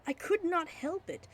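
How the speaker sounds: usual speaking voice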